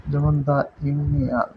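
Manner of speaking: "দা ইউনিয়ন" said slowly, "দা" as "da" before the "you" sound; "দা ইউনিয়ন": slow, clear "da". Shown as a demonstration. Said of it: "তাহলে তার উচ্চারণ হবে দা".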